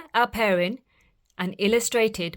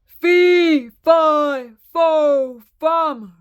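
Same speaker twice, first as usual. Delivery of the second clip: loud, high, drawn-out chant; giant's roar; 7 semitones higher, 10 dB louder, much slower than usual. A narrator's reading. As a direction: roar each word like a giant. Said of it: "roared the giant".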